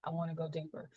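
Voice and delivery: quiet, fading repeated request; soft inner plea. Retold or imitated. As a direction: murmur it like a meditation wish. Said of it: "I kept saying".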